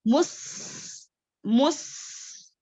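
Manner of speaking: मुस drawn out with a hissing, whistled s; slow मुस, let the s whistle; whistling s. Shown as a demonstration. Teacher showing the whistle of the seen in मुस. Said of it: "सीटी की आवाज है".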